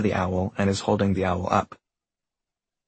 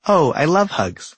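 delighted; 6 semitones higher, 3 dB louder, slower than usual. Acted. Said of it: "says the tree".

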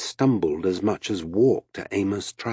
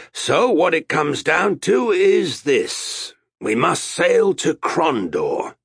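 high, loud, slow declaration; captain laying down terms; 8 semitones higher, 4 dB louder, slower than usual. A narrator's reading. Captain to the sailors' spokesman, slow emphatic address. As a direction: spell it out slowly to the crew.

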